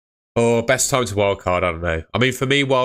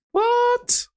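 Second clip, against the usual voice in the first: very high squeaked exclamation; high-pitched disbelief. Real, not acted. Streamer reacting to a chat member's transfer, squeaky disbelief. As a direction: squeak it out in sudden surprise.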